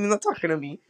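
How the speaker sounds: ordinary delivery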